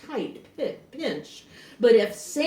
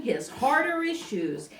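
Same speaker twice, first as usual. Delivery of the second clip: ordinary delivery, nothing marked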